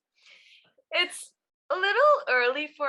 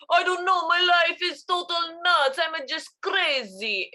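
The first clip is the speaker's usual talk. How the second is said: higher-pitched mock Spanish accent, mocking mom; 6 semitones higher than usual. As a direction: parody a shaky on-and-off Spanish accent. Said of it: "you were imitating Mama Coco".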